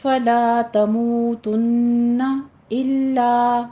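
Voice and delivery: slow فَلَا تَمُوتُنَّ with a nasal held نّ; tajweed demo. Shown as a demonstration. Teacher models the غنہ in تَمُوتُنَّ. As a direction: hum the doubled نون long. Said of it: "غنہ واجبہ کرنا ہے".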